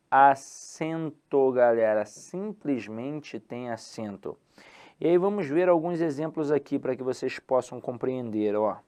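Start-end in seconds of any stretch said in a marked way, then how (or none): 0.1-1.5 drawn-out emphasis. Shown as a demonstration. Teacher stretches key word. 2.1-4.4 slow quiet restatement. Shown as a demonstration. Teacher repeats rule slowly.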